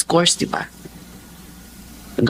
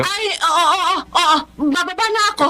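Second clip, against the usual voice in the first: flustered; 10 semitones higher than usual. Acted. Flustered, hurrying to cover up.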